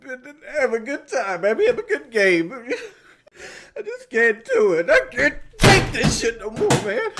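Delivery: high, whimpering sobbing complaint; laugh-cry through it, high and pleading; tearful breakdown. Acted. Streamer who just can't take it anymore, tearful breakdown.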